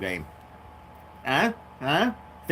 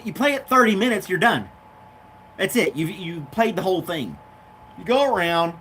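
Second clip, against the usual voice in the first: normal talking voice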